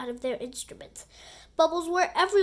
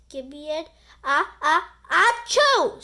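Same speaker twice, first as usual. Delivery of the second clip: sneezing cheer; 3 semitones higher, 8 dB louder than usual. Acted. Peppered cheerleaders sneeze mid-cheer, slow and loud. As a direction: let the cheer collapse into a big sneeze. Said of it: "sneezed the cheerleaders".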